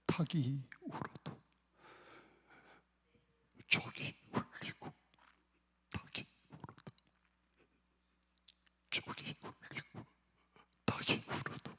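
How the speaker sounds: whispered, lingering hymn lyric; barely audible, with long solemn pauses; hushed recitation. Acted. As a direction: let each phrase fade into a whisper. Hushed repeats of sad hymn lyric.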